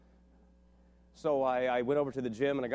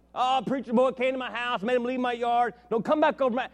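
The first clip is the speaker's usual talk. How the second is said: loud, high-pitched imitation of a heckler; mocking taunt; 11 semitones higher, 8 dB louder than usual. Hostile man making fun of the young preacher, mocking. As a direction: make fun of him in a raised, jeering voice. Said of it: "he made fun of me".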